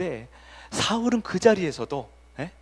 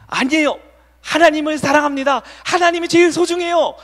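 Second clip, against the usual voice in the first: much higher, louder, earnest declaration of love; fervent confession; 12 semitones higher, 9 dB louder than usual. Shown as a demonstration. Saul's model answer to God, loud and fervent. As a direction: cry out your love to God. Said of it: "사울이 해야 되는 모범 답안".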